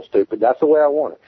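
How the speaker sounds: ordinary delivery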